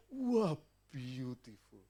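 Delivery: slow, savoured wah beautiful; admiring doctor. Retold or imitated. Preacher repeating the doctor's praise, in slow mock awe.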